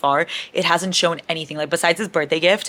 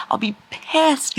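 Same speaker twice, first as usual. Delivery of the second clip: high, slow mock outrage; dramatic upset; 9 semitones higher, slower than usual. Real, not acted.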